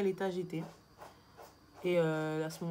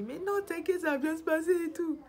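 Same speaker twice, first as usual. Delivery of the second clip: high, squeaky mock voice of a panicked husband; 11 semitones higher, 4 dB louder than usual. Wife mimicking her panicked husband's reassurance, high mocking squeak. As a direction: parody his shaky reassurance, pitched way up. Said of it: "Et le mec, il me dit".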